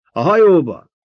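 high, loud command; urgent shout. A narrator's reading. Officer sends the emperor to the ship, urgent shout. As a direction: holler the order to get aboard. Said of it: "kiáltott egy sardalkartiszt".

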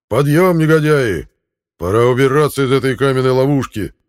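high, loud gruff order; rousing shout. Acted. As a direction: bark at the sleepers to rise. Erik rousing his men.

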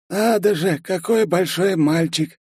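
high, slow dry remark; colourless clerk voice. A narrator's reading. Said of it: "бесцветным голосом заметил писарь".

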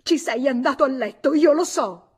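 high, full-voiced yell of accusation; furious shout. A narrator's reading. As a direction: scream the accusation in his face. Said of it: "Clotilde urlò". (urlo furioso e acuto)

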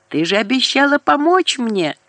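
high, accusing yell; furious. A narrator's reading. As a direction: throw the betrayal at her. Furious caller on the phone.

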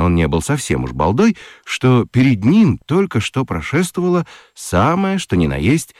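ordinary delivery

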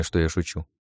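ordinary delivery

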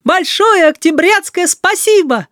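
high drawn-out loud chant; loud, ringing schoolboy thanks. A narrator's reading. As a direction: call it out slowly and high, like a pupil's salute. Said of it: "Валера громко сказал".

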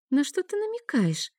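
high, guarded question; wary. A narrator's reading. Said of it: "насторожилась Нея".

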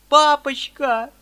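high, loud, slow cry to her father; tearful girl's shriek; anguished cry. Acted. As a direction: cry out as you rush to him. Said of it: "вскричала Саша".

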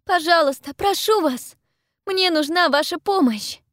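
high, loud desperate plea; urgent, high-pitched shout; crying out. A narrator's reading. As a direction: beg them loudly for help. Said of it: "кричу я".

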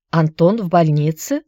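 ordinary delivery

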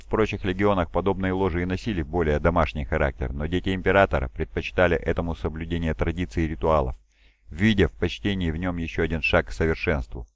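ordinary delivery

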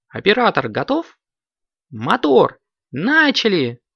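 high, energetic director's commands; commanding. A narrator's reading. Director starts the shoot, high ringing commands. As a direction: call the shots, high and forceful. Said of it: "скомандовал Коржик".

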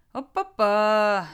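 long, loud drawn-out tut; disapproving. Acted. Jack looking over battered friend, slowly disapproving. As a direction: draw it out in mock dismay. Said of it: "неодобрительно качая головой, проговорил он".

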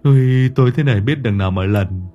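hushed, frightened stammer; trembling prayer. Acted. Hải praying alone in the dark garden, frightened. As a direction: pray in fear. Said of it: "chân tay nó run cầm cập".

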